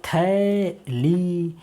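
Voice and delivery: झील stretched very slowly; drawn-out blending. Shown as a demonstration. Teacher slowly sounding out the blended word. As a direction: draw झील out for the children. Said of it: "क्या हो जाएगा?".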